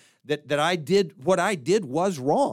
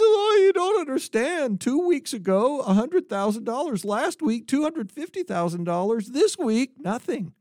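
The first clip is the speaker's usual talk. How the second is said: weeping man; 9 semitones higher than usual. Acted. Crying man wails the punchline to his friend, high and whiny. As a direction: blubber the punchline in mock despair. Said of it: "and he says".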